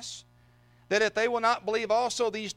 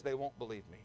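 very low, quiet letdown; dejected mumble as Moses; deflated reply; 8 semitones lower, 11 dB quieter than usual. Acted. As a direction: drop right down, glum and unconvinced. Moses deflates God's excited plan.

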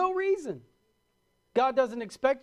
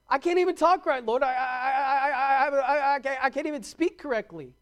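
stammering Moses; 5 semitones higher, 4 dB louder than usual. Retold or imitated. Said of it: "Moses was like".

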